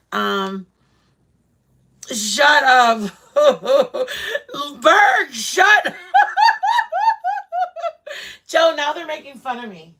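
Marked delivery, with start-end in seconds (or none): 2.1-5.9 teased wife laughing at her husband; laughing shut up. Real, not acted. Teased by someone off camera. 6.0-8.4 high-pitched burst of laughter; laughing hard at the teasing; big laugh. Real, not acted. Teased by someone off camera.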